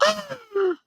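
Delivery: high, soft mock gasp; imitated scandalized old women. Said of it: "all the old women were".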